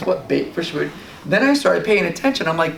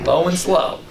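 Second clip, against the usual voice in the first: normal talking voice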